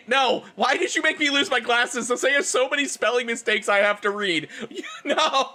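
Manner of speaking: ordinary delivery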